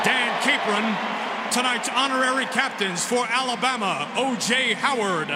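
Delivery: booming PA announcer voice; echoing stadium announcer. Acted. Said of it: "back to our P A announcer".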